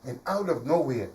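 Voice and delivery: ordinary delivery, nothing marked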